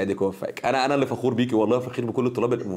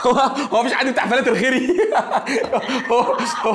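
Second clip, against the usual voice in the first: much higher, louder, laughing through the question; 12 semitones higher, 5 dB louder than usual. Real, not acted. Teacher laughing loudly after a student guesses his name. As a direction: burst out laughing at being recognized.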